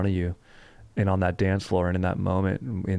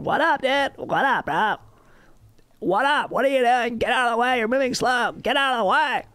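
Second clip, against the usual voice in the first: put-on high, whiny bratty teen voice; mocking teenage skater. Mocking how teens at the skate park talk, high and bratty. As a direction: sneer it like a snotty skate park kid. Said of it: "talk like this".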